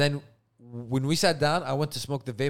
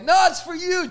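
excited reveal; 7 dB louder than usual. Retold or imitated. Surprise reveal.

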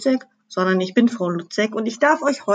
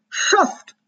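angry yell; 10 semitones higher than usual. A narrator's reading. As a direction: hurl the insult across in anger. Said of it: "brüllte er wütend zu Grabsch hinüber". (wütend gerufen)

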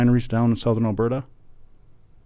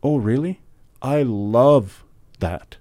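slow, drawn-out mock enthusiasm; mimicking Samantha; much slower than usual. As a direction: imitate her breezy reply, stretching the words. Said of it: "Samantha's response was".

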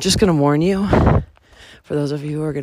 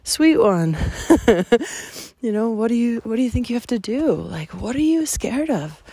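tender; 5 semitones higher than usual. Retold or imitated. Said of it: "asking it questions like".

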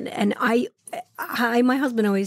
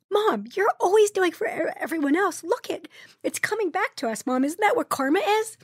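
higher-pitched, emotional imitation of the kids; older kids to mom; 8 semitones higher than usual. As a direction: voice your children earnestly reassuring their mom. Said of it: "this is the thing about bigger kids".

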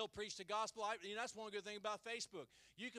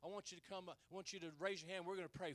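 ordinary delivery

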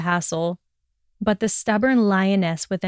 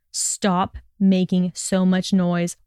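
usual speaking voice

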